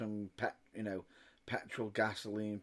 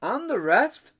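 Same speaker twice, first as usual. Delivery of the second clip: exclaimed; 12 semitones higher, 12 dB louder, slower than usual. Real, not acted. Joking about prices.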